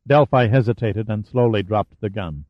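ordinary delivery, nothing marked

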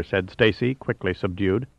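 usual speaking voice

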